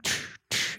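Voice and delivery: whispered beat noise; unvoiced tsh tsh beat; beat sounds. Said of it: "I'm like".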